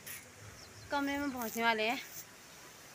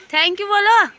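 loud prompting; 9 semitones higher, 14 dB louder than usual. Real, not acted. Aunt loudly telling Kavya to thank her uncle, high call. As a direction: call over to remind her of her manners.

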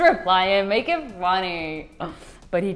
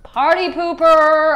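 teasing; 8 semitones higher, 10 dB louder, slower than usual. Pretend. Teasing text read aloud.

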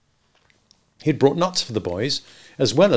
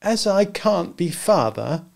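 put-on slow, higher, dejected boy; said heavily; 8 semitones higher, slower than usual. A narrator's reading. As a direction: sigh it out heavily. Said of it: "he said heavily".

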